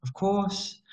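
high, bright Malaysian English with lah; imitating a Malaysian accent. Malaysian local answering a visitor, in Malaysian accent. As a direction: lift it up in a Malaysian lilt. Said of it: "They'll go".

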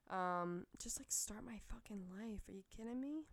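hushed excited whisper. Real, not acted. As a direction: breathe it out under your breath. Excited aside to herself.

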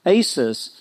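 normal talking voice

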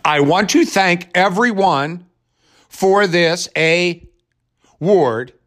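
high, very slow, stilted interpreter voice; a hammed-up interpreter reading a deaf winner's speech; mock sign-language interpreter. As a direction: drag out each word like a wooden translator. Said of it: "you're gonna hear some narrator going".

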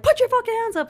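very high, loud mock shout; sharp rise into a police bark; retold yelling. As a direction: imitate a cop yelling an order. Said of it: "they're still yelling".

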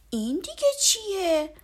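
put-on high, loud childlike surprise; a boy puzzled by the old blanket; surprised boy. A narrator's reading. Boy surprised at father's yellow blanket, high. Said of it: "با تعجب پرسید".

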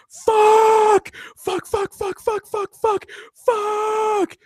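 re-enacted high-pitched yelling of curses; shouted profanity. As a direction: yell it way up high, a long cry, rapid repeats, then another long one. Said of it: "not supposed to be shouting profanity".

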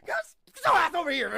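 high, loud hammed-up command; playful demanding shout. Man dragging his girlfriend back, mock shouting. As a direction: yell it, on the edge of laughing.

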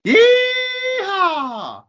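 loud high cowboy whoop; yeehaw. Acted. Jingle for cowboy news segment.

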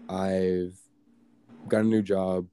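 usual speaking voice

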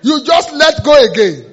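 loud, high, slow exclamation; shouted punchline. Real, not acted. Preacher capping the failed-resolution story, shouted punchline. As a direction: holler it out, stretching the words.

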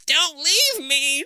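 slow, high, loud begging; desperate plea. A narrator's reading. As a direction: plead desperately. Todd begging.

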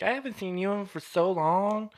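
put-on high singsong voice. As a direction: gush it way up high, imitating him. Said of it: "No, he didn't talk like that before".

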